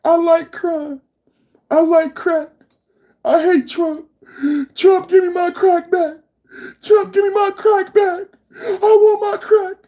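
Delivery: high, hammed-up tearful wail; mock crying. Host imitates crying Trump haters missing their crack, mock sobbing. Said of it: "They gonna be crying".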